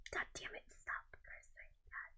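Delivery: whispered self-scolding. Real, not acted. Scolding herself for cursing, whispering. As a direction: hush yourself to stop swearing. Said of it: "I'm really cursing today".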